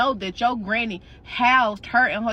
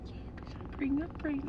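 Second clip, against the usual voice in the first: voiceless, then barely voiced and soft; whispered confession; 2 semitones higher, 13 dB quieter, faster than usual. Answering her friend mid-hug, whispering. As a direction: confess it under your breath.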